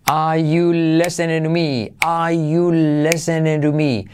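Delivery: "Are" as a long "ah", all linked slowly; slow dropped-R model. Shown as a demonstration. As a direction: draw out "Are you listening to me" without R or T. ("Are you" lento, sin la R)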